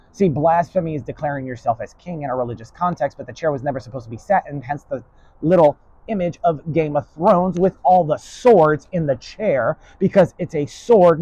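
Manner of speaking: usual speaking voice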